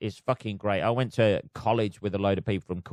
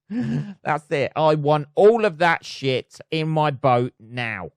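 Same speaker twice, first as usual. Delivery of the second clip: raised pitch, loud and drawn out; greedy colonialist; 6 semitones higher, 5 dB louder, slower than usual. Pretend. Mock colonialist demanding all of it for the boat, loud and greedy.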